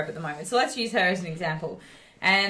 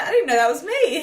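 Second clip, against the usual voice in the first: higher, louder, laughing amazement; amazed delight; 8 semitones higher, 7 dB louder than usual. Retold or imitated. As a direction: imitate her thrilled disbelief. Quoted client seeing her photo.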